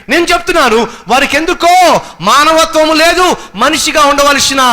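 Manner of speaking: high, loud rebuke from the pulpit; fiery preaching. Real, not acted. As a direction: thunder it at them. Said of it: "preacher rebukes at full force". (ప్రసంగంలో గట్టిగా, ఎత్తైన స్వరంతో గద్దింపు)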